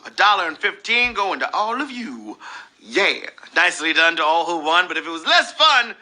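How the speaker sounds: ordinary delivery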